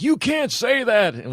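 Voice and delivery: put-on high, slow old man. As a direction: imitate a fired-up old man. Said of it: "he was like".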